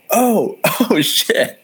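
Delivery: jumps way up high, then drops to a breathy "shit"; a squeaky, startled "oh, oh", then the swear breathed out; caught-off-guard gasp. Retold or imitated. The guys realize, caught off guard.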